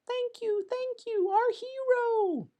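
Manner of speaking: tiny squeaky gnome voice, slow on the last words; a grateful gnome squeaks its thanks to the hero; squeeing gnome. Acted. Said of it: "Squeeing with delight".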